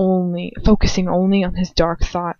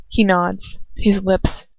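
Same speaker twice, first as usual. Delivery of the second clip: ordinary delivery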